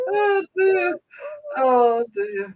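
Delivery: high, giggly recovery from laughter; laughing sigh. Real, not acted. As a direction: let it out high as the laughing fit subsides.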